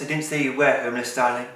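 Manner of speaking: normal talking voice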